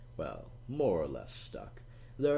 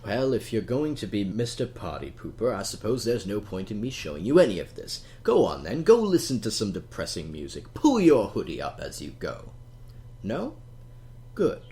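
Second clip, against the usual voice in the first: mock dismissive; 2 semitones higher, 7 dB louder than usual. Teasing sulky listener away.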